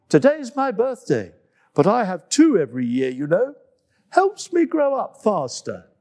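put-on high, bouncy child voice; a small animal boasting about her birthdays; little monkey Coco. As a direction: chirp it like an excited little monkey. Coco speaks in story.

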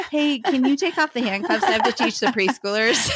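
high, giggling pretend request; laughing mock plea. Imagined handcuffed arrival at church.

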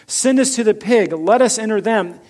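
loud, high pleading; begging. Acted. Demons beg Jesus to send them into pigs, loud pleading.

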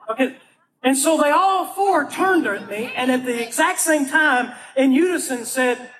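usual speaking voice

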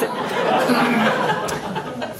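soft unvoiced giggle; short airy chuckle; breathy laugh. Real, not acted. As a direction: laugh quietly at yourself. Laughing at her own story.

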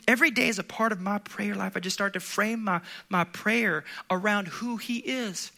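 normal talking voice

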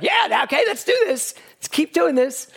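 high, keen inner voice saying let's go; eager inner voice. Retold or imitated. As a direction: pump yourself up to go again. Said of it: "something in you that's like".

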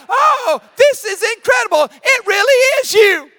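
squealing high excitement; overjoyed. Retold or imitated. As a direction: act out being thrilled beyond belief. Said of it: "she was so overjoyed".

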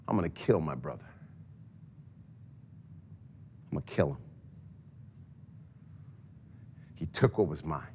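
low, soft, brooding vow of revenge; low soft menacing vow; low murmured threat. Acted. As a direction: murmur it low to yourself, nursing a grudge. Esau vowing revenge to himself.

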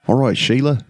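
a quick light Australian "All right, Sheila"; Australian accent. Retold or imitated. Joking how to sound Australian, light Australian accent. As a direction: toss it off in an easy Aussie accent. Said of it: "you can just be like".

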